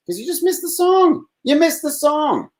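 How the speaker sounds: very high, drawn-out mock wail; high sing-song lament. To listeners who missed the song, a high mock wail. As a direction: whine it up high like a cartoon.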